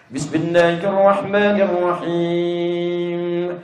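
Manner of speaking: very slow, long-held chanted bismillah; imam reciting aloud. Acted. Over-zealous imam leads maghrib prayer, chanted aloud. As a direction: chant it out, stretching the last syllable. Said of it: "Jadi imam dia".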